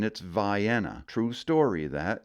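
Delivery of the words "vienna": Vienna slow, vye-EN-uh; the Maine way. Shown as a demonstration. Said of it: "here in Maine".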